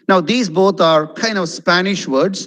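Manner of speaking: usual speaking voice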